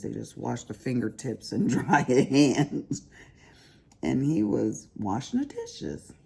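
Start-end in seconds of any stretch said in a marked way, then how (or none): none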